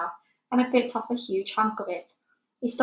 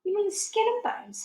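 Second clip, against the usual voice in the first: put-on much higher, a small girl correcting gently; little girl Sophie; 12 semitones higher than usual. A narrator's reading. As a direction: lift the voice high like a child. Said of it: "Sophie said".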